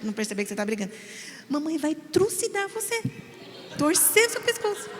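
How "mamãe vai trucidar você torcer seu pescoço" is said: mock-sweet threat through a smile; smiling threat. Shown as a demonstration. Mother scolding her son in public, smiling threat. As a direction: smile while threatening so nobody notices. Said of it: "rindo assim".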